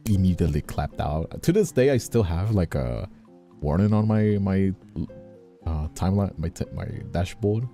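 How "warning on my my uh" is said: ordinary talk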